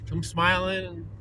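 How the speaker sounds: usual speaking voice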